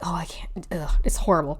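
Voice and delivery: whispered, mock anguish over the text. Reading his text aloud, in a whispered mock anguish. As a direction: breathe it like a fake fretful sigh. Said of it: "how he's just like".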